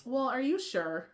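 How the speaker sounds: high, slow questioning of a warning; mock doubting. Questioning Spirit's warning, mock doubting. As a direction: draw it out high, as if second-guessing.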